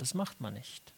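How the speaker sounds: soft, low, resigned restatement of "das macht man nicht"; quiet echo. Shown as a demonstration. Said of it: "Und der Standard ist einfach der Anstand".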